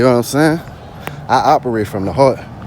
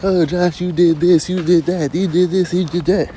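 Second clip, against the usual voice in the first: higher-pitched mocking imitation of a critic; mock accuser; 7 semitones higher than usual. Imagined critic listing what Josh did, mocking higher voice. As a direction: mimic a nagging accuser in a raised voice. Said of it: "I'd probably be like".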